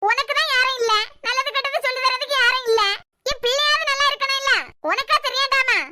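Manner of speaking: heated, insistent reproach; berating. Acted. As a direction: lay into her like an angry mother. Mother berates young woman.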